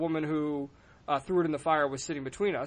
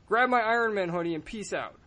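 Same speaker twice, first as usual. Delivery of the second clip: normal talking voice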